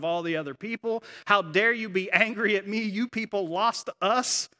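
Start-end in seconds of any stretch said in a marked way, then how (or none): 1.3-4.5 higher-pitched, mildly raised angry protest; retold indignant yell. Child yelling back at parents in front of everyone, retold indignant yell. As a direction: retell an outraged outburst at parents. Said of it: "yelling at them in front of".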